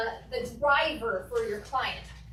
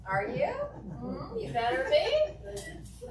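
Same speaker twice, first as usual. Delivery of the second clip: teasing; 9 semitones higher, much slower than usual. Real, not acted. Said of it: "teasing her role players".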